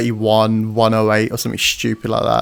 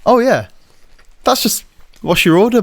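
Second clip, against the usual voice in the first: sudden realisation; 9 semitones higher than usual. Retold or imitated. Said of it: "And I was like".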